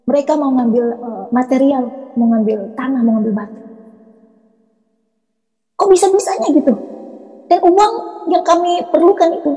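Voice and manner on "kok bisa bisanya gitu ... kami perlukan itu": high-pitched amazed question. Real, not acted. Telling listeners of an unexpected job offer, amazed. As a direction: marvel at it, pitch jumping up. Marvels at sudden answered prayer.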